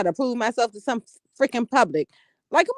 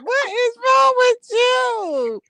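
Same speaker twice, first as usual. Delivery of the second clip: higher, louder, very slow laughing shout; 12 semitones higher, 5 dB louder, much slower than usual. Real, not acted. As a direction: drag it out, cracking up. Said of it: "What are you laughing at?".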